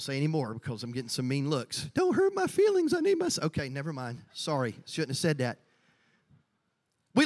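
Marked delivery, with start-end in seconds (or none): 1.9-3.4 high, whiny put-on voice; mock hurt feelings. Preacher mocking touchy millennials, high-pitched whimpering. 3.4-5.6 low, soft backpedal; mock-sheepish apology. Preacher apologizing to church after mean looks, low and sheepish. Backs off his joke in aside.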